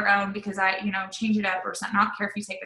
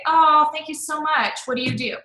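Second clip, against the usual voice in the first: gushing prospect; 7 semitones higher, 4 dB louder than usual. Retold or imitated. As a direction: imitate an eager prospect thanking her. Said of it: "they always say".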